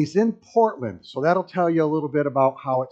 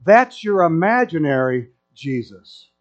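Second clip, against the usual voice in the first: louder, slow declaring voice; burly bearded man; 3 semitones higher, 6 dB louder, slower than usual. Retold or imitated. Burly first century man corrects the Portland guy, louder and slower. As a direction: set him straight, slow and loud. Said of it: "He goes".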